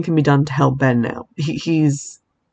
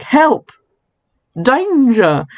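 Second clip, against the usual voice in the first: higher, half-acted call for help; mock cry for help; 8 semitones higher, much slower than usual. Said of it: "she calls the police and is like".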